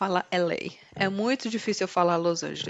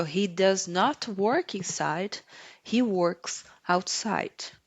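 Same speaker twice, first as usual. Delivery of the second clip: slow, deliberate example; slow teaching voice; slower than usual. Shown as a demonstration. Teacher slowly reads the example to students. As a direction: spell it out for learners. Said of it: "teaching example sentences".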